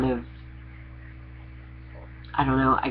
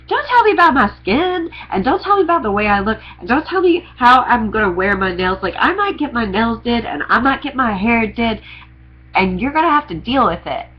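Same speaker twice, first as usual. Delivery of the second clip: defiant outburst; 5 semitones higher than usual. Real, not acted. To people judging her looks, a high defiant rant. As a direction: push back hard at the critics.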